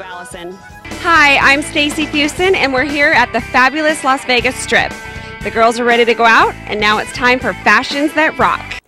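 ordinary delivery

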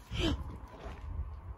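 sharp frightened intake of breath; startled gasp. Real, not acted. Saw someone walk across door.

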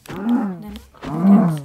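long drawn-out shout on pickaxe; playful drawn-out holler; stretched-out yell. Real, not acted. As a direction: bellow the word out slowly. Listing weapons, sudden stretched yell.

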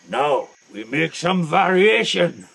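normal talking voice

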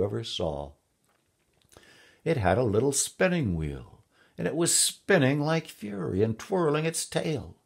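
lively king recounting an adventure; king telling his tale. A narrator's reading. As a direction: relish the funny tale, swooping up high. King tells his amusing hunting tale.

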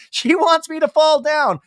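high, loud mock complaint; whiny Scheana voice. Scheana complaining about her daughter, high whiny voice. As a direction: whine it in mock outrage. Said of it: "she's like".

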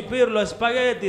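normal talking voice

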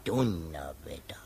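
slow, hushed amazement; awed whisper. Real, not acted. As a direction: breathe it out in awe. Listener amazed by the story. (staunend geflüstert)

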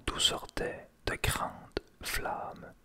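ordinary delivery

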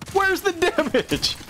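high, drawn-out exasperated wail; exasperated cry. Real, not acted. Gamer complaining about the damage, high exasperated wail.

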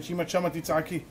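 ordinary talk

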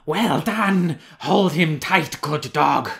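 top-of-the-voice, high triumphant yell; exultant shout. A narrator's reading. Outram exultant as his dog catches up, loud high shout. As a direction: praise the hound at full volume. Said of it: "shouting at the top of his voice".

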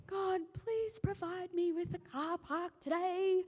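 high, slow, pleading little prayer. Retold or imitated. Speaker imitates her own parking prayer, high slow pleading. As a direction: beg God sweetly for a parking spot. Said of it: "I do the classic".

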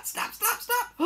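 high, sharp imitated snaps; snapping jaws. Baby Dino Pigs snapping at Harold, quick high snaps. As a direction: fire off snaps like hungry hatchlings.

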